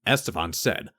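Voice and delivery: ordinary delivery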